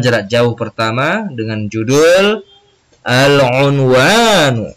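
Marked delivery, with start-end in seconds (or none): 3.0-4.7 slow model reading. Shown as a demonstration. Teacher models Arabic title word.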